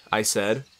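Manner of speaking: plain reading voice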